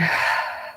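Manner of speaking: long audible sigh out; demonstrative exhale. Shown as a demonstration. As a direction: let the breath out slowly to show relaxing. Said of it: "And exhaling".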